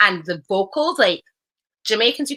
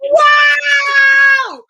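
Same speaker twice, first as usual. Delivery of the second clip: loud, high, drawn-out shriek of wow; real outburst of mock outrage, stretched long; astonished shout; 10 dB louder than usual. Real, not acted. Reacting to the verdict on who does it better, astonished shout.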